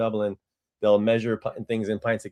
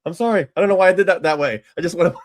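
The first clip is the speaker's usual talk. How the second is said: embarrassed laughing; 8 semitones higher, 4 dB louder, faster than usual. Retold or imitated. Embarrassed self-quote, laughing.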